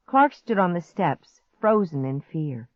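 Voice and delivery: ordinary delivery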